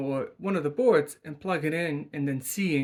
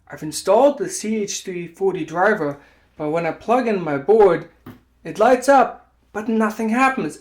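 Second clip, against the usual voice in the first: hammed-up frustration, higher and louder; bad acting; 4 semitones higher, 9 dB louder than usual. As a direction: play an exasperated user, overacting. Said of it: "excuse my bad acting".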